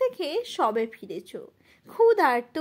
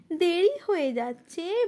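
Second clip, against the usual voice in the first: ordinary talk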